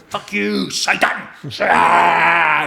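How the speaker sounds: hammed-up terrified yell at the devil; high outburst breaking into a scream; panicked screaming. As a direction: scream as if fleeing Satan. Acted panic at supposed Satan.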